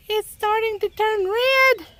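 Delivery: high, drawn-out squeal of discovery; delighted high-pitched exclamation; excited squeal. Real, not acted. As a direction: marvel in a high, slow, stretched-out voice. Spots the plant changing colour.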